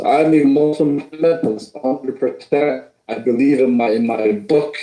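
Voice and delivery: ordinary talk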